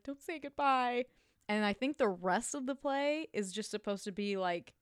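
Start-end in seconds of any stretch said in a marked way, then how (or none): 0.0-1.0 higher desperate plea; pleading. Retold or imitated. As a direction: beg him not to leave. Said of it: "And she, and she's like".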